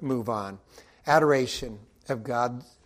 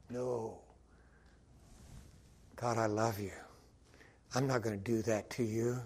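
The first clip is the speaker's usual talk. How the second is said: much quieter, tender and sincere; soft devotion; 5 semitones lower, 10 dB quieter than usual. Retold or imitated. Believer quietly tells God he loves him, tender and hushed. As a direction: turn back to God softly and lovingly. Tender answer after mock rant.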